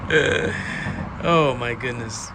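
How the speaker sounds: slow, drawn-out amazement; slow awestruck exclamation; awed. Real, not acted. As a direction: savour each word in wonder. Amazed by the reset.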